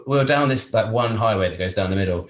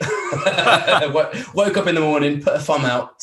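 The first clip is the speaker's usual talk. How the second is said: laughing; 7 semitones higher, 6 dB louder, slower than usual. Real, not acted. Laughing through the punchline.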